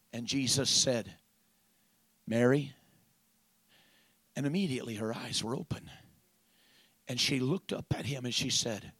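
very low, soft voice; hushed storytelling. Acted. Preacher retelling Mary recognising the risen Jesus, hushed. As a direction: drop right down to a hush, letting pauses hang.

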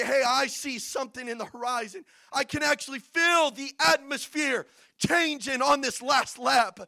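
exultant, shouted pulpit delivery; preaching at full force. Real, not acted. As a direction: drive the sermon up to its climax.

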